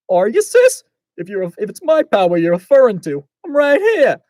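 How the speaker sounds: loud, high Brooklyn brother; Brooklyn accent. Acted. Blaze revealing himself to his sister, Brooklyn accent. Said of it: "Blaze sounds like he's from Brooklyn".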